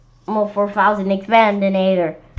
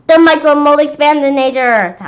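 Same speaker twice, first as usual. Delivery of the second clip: monster demand; 6 semitones higher, 11 dB louder than usual. Acted.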